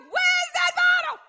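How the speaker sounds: very high, mock frantic holler; high, hammed-up yell; acted holler. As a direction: holler it like a frazzled parent. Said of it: "And you holler".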